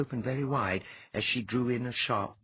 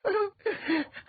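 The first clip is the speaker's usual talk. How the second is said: put-on high, bright boyish chuckle; a sudden amused chuckle. A narrator's reading. Geher noticing Undine feeding Ilya, chuckling. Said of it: "Geher suddenly chuckled".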